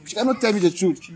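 high-pitched angry accusation. Real, not acted. Man accusing his partner of lying, angry. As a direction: accuse with real, rising anger. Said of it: "That's why I'm angry".

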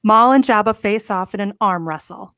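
ordinary talk, nothing marked

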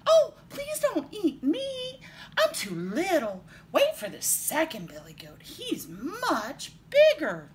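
high, stretched little-goat plea; small goat pleading. A narrator's reading. Youngest goat begging the troll, small and pleading. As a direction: beg in a tiny high voice. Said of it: "in his very small voice".

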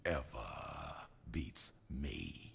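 low whispered threat, fading away; menacing whisper. Acted. Boss taunts the up-and-comers in a low whispered threat. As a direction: drop to a hushed, threatening hiss.

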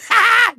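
short, high villain laugh; self-amused cackle. Acted. Villain pleased with his nighty night joke, cackling. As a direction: chuckle at your own pun.

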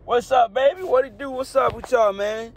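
high, loud hype greeting; big energetic shout-out to the viewers; sing-song hype yell; hyped intro. Real, not acted.

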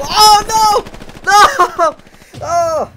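high loud cry of dismay; panicked shout. Acted. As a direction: yell in panic. Dying in game.

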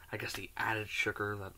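chewing while talking; speaking between bites; mouth full of cookie. Real, not acted. Seen 3: Bites cookie just before; mouth works food early, cookie held throughout.

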